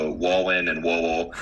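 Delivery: "Wowin and Wowel" with W for every R and L; R's and L's as W. Acted. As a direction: say "Wowin and Wowel" like a speech-impaired kid. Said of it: "couldn't say R's or L's".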